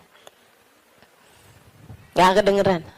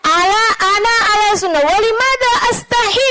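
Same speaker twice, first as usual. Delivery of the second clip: high, emphatic acted protest; indignant self-defence; 11 semitones higher, 4 dB louder than usual. Syekh defending his sunnah act, indignant protest. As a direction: protest as if wrongly shamed.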